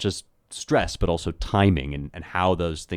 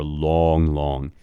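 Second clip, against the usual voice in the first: "long, long" each drawn out evenly; two long beats; 2 semitones lower than usual. Shown as a demonstration. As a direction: stretch both words of "long, long". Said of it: "and spondies".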